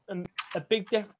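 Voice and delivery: usual speaking voice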